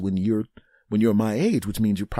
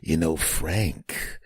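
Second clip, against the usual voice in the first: low, slow, half-whispered cop line; Horatio Caine; 5 semitones lower, much slower than usual. Retold or imitated. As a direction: drop to a hushed, dramatic Horatio Caine delivery. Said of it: "Horatio Caine would ... he'd go".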